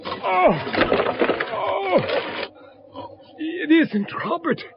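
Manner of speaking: high gasping cries of shock; horrified shock. Acted. Father finding a stranger in his son's coffin, horrified. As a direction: recoil at what lies in the coffin.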